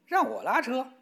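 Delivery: high, indignant rooster shout; rooster's indignant cry. A narrator's reading. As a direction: squawk it out in disbelief. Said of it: "公鸡嚷了起来". (公鸡气愤地尖声嚷叫)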